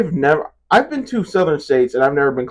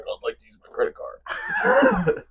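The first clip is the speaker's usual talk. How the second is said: mock American accent. Imitating a video of an American paying, mock American accent. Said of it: "Let me show you how Americans do it".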